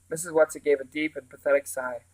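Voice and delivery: usual speaking voice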